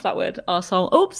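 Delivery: ordinary talk, nothing marked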